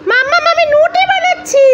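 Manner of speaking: put-on high, cheeky little boy voice; child voice. Little son teasing his mother, in a high cheeky child voice. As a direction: play the teasing toddler answering mummy.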